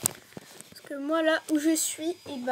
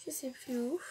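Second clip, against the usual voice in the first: whispering; 3 semitones lower, 10 dB quieter than usual. Real, not acted. Aside while listing shops.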